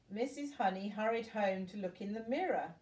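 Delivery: ordinary delivery, nothing marked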